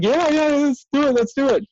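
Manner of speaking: high, eager egging on; excited old coach. Retold or imitated. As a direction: cheer him into the fight. Old boxing guy cheering him on.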